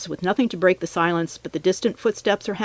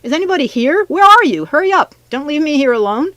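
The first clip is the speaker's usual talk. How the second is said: high, loud shrieks for help; frantic freshman shrieking for rescue; panicked shrieking; 10 semitones higher, 8 dB louder than usual. A narrator's reading. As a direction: cry out in rising panic. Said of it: "she was shrieking".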